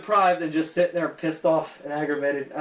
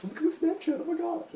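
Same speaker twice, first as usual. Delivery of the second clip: high, airy mock of a vapid kid; mocking teen voice; 4 dB quieter than usual. Tired guy imitating the kids at the party in a high mocking voice. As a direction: sneer at a bored teenager showing off his phone.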